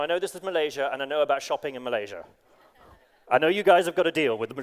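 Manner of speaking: ordinary talk, nothing marked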